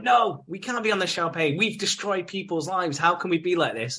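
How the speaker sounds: high-pitched retold protest; mock indignation. She refuses the champagne, mock-indignant. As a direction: protest earnestly, slowing midway, as imitation. Voiced guilty objection to champagne.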